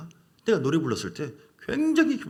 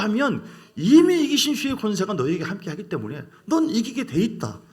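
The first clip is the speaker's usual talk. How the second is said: voice of Jesus; 4 semitones higher, 4 dB louder than usual. Retold or imitated. 예수님의 말씀을 전하는 목사, 점점 커지는 위엄 있는 선포. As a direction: proclaim it with rising authority. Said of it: "예수님께서 하는 말은".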